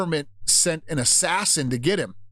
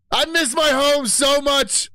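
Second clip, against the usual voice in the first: high, slow, loud lament; hammed-up homesick cry; mock wailing; 12 semitones higher, 5 dB louder, slower than usual. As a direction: wail it again for home.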